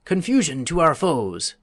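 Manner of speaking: loud, slow hissed threat. A narrator's reading. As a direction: hiss it at the foes. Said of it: "hissed Victor".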